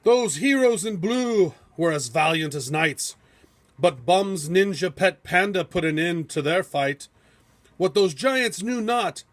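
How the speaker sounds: ordinary delivery, nothing marked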